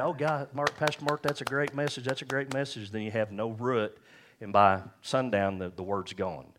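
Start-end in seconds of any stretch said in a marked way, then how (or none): 0.0-2.9 high-pitched gushing churchgoer; overjoyed mock praise, pitched up; gushing gladness. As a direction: imitate a thrilled listener praising the preacher. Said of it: "you get all glad".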